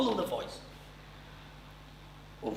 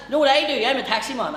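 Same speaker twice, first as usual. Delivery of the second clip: put-on Newry accent; 8 semitones higher, 7 dB louder than usual. Newry man voiced.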